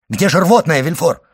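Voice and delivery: urgent demand. A narrator's reading. Doctor demands the emetic for a dying man, urgently. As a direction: demand it in alarm.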